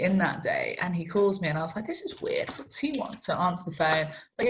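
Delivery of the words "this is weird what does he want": hushed, higher puzzled aside; retold thought in a small high voice. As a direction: wonder it under your breath. Retold inner puzzlement at phone.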